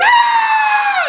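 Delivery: high, loud mock scream of terror. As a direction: shriek like you're dragged to hell. Bobby screaming in hell.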